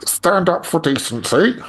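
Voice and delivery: high, slow politician impression; Keir Starmer. Asked to repeat the slogan in a politician's voice, mock-earnest. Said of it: "in your Keir Starmer voice".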